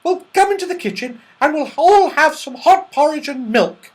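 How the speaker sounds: Goldilocks, hospitable. A narrator's reading. Goldilocks invites all into the kitchen, high and eager. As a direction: invite them in, pitched right up.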